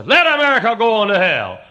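high preacher's shout as God; thundering judgment. Acted. The Lord looking down on America, shouting judgment. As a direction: bellow it down from heaven.

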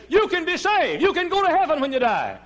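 high, loud preaching cry; full-force preaching. Acted. Preacher crying out salvation to the congregation, full force. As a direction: ring it out from the pulpit.

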